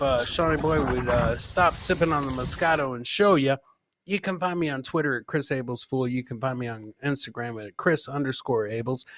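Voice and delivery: normal talking voice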